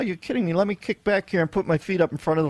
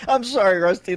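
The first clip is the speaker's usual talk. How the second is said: usual speaking voice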